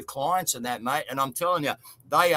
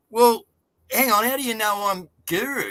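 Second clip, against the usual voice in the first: taken aback; 6 semitones higher, 4 dB louder than usual. Retold or imitated. [name] retells his startled reply.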